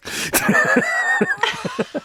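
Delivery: long high airy laugh; high breathy laugh. Real, not acted. Laughing after a rhetorical question.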